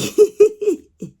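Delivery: loud, high real laugh; burst of laughter. Real, not acted. Laughing at the repair guy's remark about Pam, loud real laugh. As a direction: crack up out loud. Laughing at her own story.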